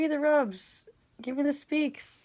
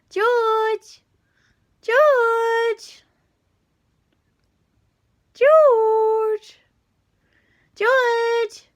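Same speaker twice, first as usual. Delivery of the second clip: high loud call to a cat; 8 semitones higher, 9 dB louder, much slower than usual. Real, not acted. Owner calling her cat across the room, loud high call. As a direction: sing out the cat's name, high and loud.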